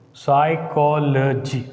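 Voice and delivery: "Psychology" slow and evenly segmented; divided into syllables. Shown as a demonstration. Teacher counting syllables with the class, slow and divided. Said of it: "dividing it".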